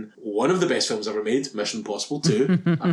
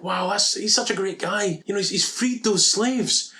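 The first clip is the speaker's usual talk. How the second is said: higher, gushing fake awe; mock admiration; 6 semitones higher than usual. Podcaster parodying viewers meant to admire him, mock admiration. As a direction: gush sarcastically.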